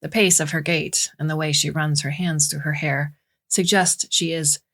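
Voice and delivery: plain reading voice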